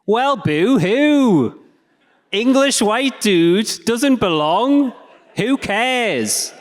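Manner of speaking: high, loud, slow sarcastic heckle; mock the speaker's self-pity; high mocking voice of an unsympathetic listener; mocking boo-hoo. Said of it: "you're probably thinking".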